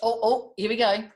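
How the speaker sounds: plain reading voice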